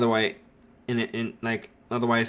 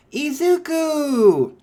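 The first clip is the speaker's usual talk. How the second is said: high, drawn-out happy call, louder; 14 semitones higher, 6 dB louder, much slower than usual. Retold or imitated. Ochako greeting Izuku from behind, bright high call. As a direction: sing his name out across the room.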